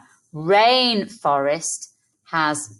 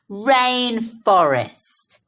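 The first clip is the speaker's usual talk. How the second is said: "Rainforest" read very slowly, syllable by syllable; slow careful read; 4 semitones higher, much slower than usual. Shown as a demonstration. Teacher reading the long word back slowly to children. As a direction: draw the word out. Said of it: "We have written quite a long word".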